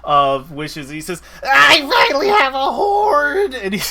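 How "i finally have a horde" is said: a dragon who finally has his hoard, yelling in delight; overjoyed shout. Acted. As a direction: shout it out, high and loud with joy. Excited dragon shouts his joy.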